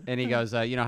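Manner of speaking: ordinary talk, nothing marked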